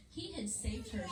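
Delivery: ordinary talk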